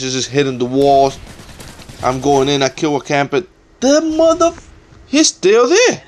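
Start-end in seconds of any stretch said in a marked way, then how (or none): 3.8-6.0 startled outburst. Real, not acted. Enemy camper still in house.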